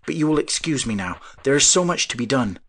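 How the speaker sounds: abrupt. Acted. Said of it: "said the inventor abruptly".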